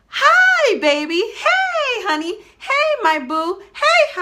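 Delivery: light up as you greet your little one; gushing greeting. Shown as a demonstration. Parent greeting child at classroom pickup, gushing and delighted.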